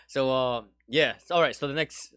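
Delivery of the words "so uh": ordinary talk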